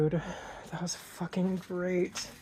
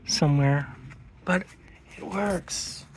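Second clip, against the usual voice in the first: ordinary talk, nothing marked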